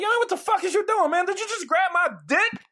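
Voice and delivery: high, loud mock outrage; hammed-up shouting at a groper. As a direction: yell it across the whole party. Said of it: "Real loud".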